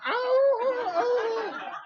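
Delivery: very high, loud mock howl; dog howling. Dogs howling at the women's ultrasonic coo. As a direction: howl like a dog hearing a whistle. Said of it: "the dogs were going".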